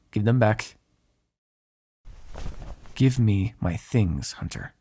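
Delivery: slow, low, measured demand; stern demand. Acted. Vampire orders hunter to return belongings, stern demand. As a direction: space out each word, dropping away on the name.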